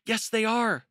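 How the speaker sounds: high, loud panicky retort; frightened storybook rabbit, loud and high; alarmed insistence. A narrator's reading. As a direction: argue back in high-pitched alarm. Benjamin insists they are coming.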